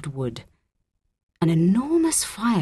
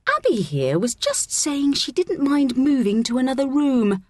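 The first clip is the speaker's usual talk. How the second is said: high, sweetly innocent fib; falsely helpful schoolgirl, pitched up; put-on innocence; 10 semitones higher, 4 dB louder than usual. A narrator's reading. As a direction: cover up sweetly in front of the teacher. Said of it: "Kira changed completely".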